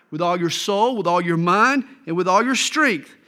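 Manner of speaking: ordinary talk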